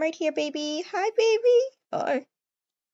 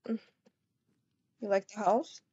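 ordinary talk

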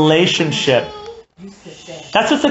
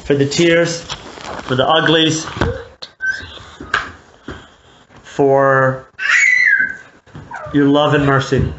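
closing prayer; 4 semitones lower than usual. Acted. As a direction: pray it humbly, fading down at the end. Closing prayer after sermon.

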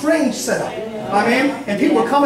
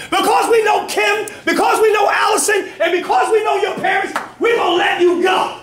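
full-force preaching; 6 semitones higher than usual. Acted.

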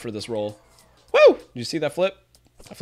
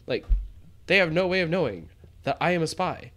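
ordinary delivery

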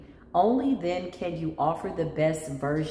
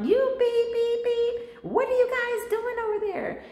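hammed-up furious caller, high-pitched; mock angry caller. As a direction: squeal it like an irate customer calling in. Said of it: "I had people curse me out".

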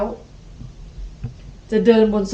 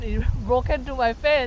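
usual speaking voice

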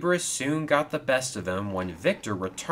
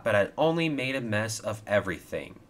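plain reading voice

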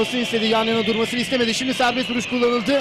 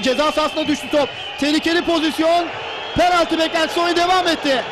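excited play-by-play; 6 semitones higher than usual. Real, not acted. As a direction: call the danger in the box. Live play-by-play, penalty shout.

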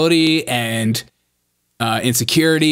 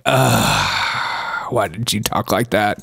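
groaning dismay; 8 semitones lower, much slower than usual. Retold or imitated. Host voicing the two podcast men's reaction, groaning dismay. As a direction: voice their silent cringe, pitched down. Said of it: "They're like".

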